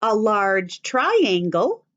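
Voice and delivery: normal talking voice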